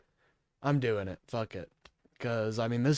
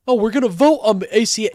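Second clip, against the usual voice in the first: high, fast, loud mock promise; sneering imitation, loud and quick; mocking quote; 7 semitones higher, 7 dB louder, much faster than usual. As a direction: parrot their promise with scorn, pitched up and rushed. Mocks the senators' deal, quoted.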